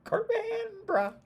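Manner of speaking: very high, drawn-out cartoon voice; Cartman. Quoting South Park for the odd ending, high cartoon impression. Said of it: "Like Cartman Bruh from South Park".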